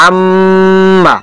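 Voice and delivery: আম্মা with a long, steady hum on ম; held ghunnah. Shown as a demonstration. Teacher demonstrating ghunnah to students, আম্মা with prolonged ম. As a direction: hold the ম of আম্মা very long, then release. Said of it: "এখানে কিছুক্ষণ সময় দূরে রাখতে হবে".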